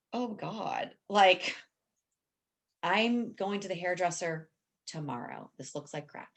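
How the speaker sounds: soft embarrassed brush-off; answering a compliment, dismissive and soft; mock self-dismissal. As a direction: wave the compliment away under your breath. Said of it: "dismissing a compliment, mock".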